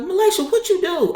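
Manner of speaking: high-pitched imitated doctor; alarmed questioning. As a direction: exclaim it way up high, mock dismay. Doctor reacts to the commotion.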